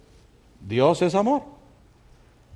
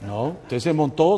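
usual speaking voice

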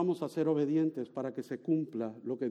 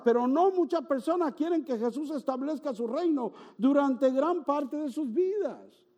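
higher, slow, emphatic pulpit voice; impassioned preaching; 10 semitones higher than usual. Acted. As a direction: lean into it with pastoral urgency. Preaching rises again.

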